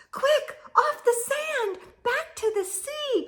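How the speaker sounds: panicked little snail shouting; urgent cry. Acted. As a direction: push him back to the sea. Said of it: "cried the snail".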